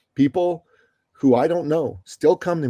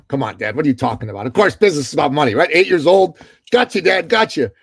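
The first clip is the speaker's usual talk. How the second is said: put-on fast, louder cocky kid retort; smart aleck kid; 5 dB louder, faster than usual. Eight-year-old son teasing his dad, fast and cocky. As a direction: rattle it off like a know-it-all boy. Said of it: "I was a smart aleck kid".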